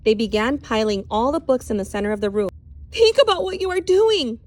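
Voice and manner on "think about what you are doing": high, loud desperate plea; crying out. Acted. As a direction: beg the mob to stop. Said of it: "cried Miss Catherine".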